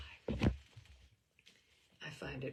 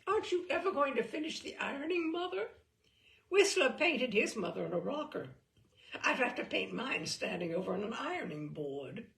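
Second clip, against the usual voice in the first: put-on bright, quick, higher-pitched teenage teasing; teasing daughter; 5 semitones higher, 7 dB louder than usual. Daughter teasing her mother at the ironing board, bright and fast. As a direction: tease your mother cheerfully and fast.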